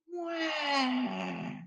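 high, hammed-up baby wail; mock crying wail. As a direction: wail like a crybaby. Mocking a crying player.

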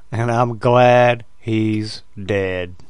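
slow, drawn-out gloating villain quote; Jack Nicholson's Joker. Retold or imitated. Quoting a movie villain when people have passed, slow Joker impression. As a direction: savour each word slowly in a Joker impression. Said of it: "I've quoted Jack Nicholson's The, uh, The Joker".

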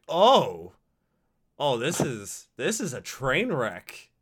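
ordinary delivery

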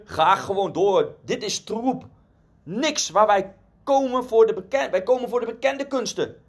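high indignant protest, mildly acted; angry shouting. A narrator's reading. Angry bidders rejecting the painting, high mock-indignant calls. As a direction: protest high like a fed-up crowd, short of a real shout. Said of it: "mensen begonnen boos te worden en schreeuwden".

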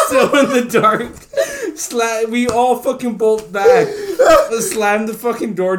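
high, loud, laughing through words; can't stop laughing; real laughter; laughing hard. Real, not acted.

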